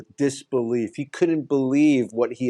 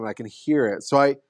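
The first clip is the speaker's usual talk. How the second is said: normal talking voice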